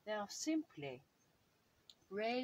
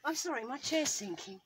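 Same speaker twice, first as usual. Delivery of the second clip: ordinary talk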